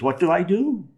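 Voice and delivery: high, pleading mock helplessness; lightly acted despair; devastated. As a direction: plead for guidance, pitch lifted.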